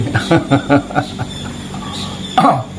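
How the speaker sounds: long, soft mocking chuckle; taunting laugh. Real, not acted. Commentator taunting Rizik's silence, mocking laugh.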